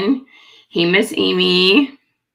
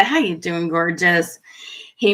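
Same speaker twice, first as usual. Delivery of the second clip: ordinary delivery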